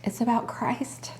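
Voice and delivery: choked-up slow whisper; holding back tears, whispered; emotional whisper. Real, not acted. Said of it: "why I'm emotional right now".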